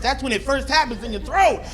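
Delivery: ordinary talk, nothing marked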